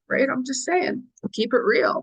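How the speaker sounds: normal talking voice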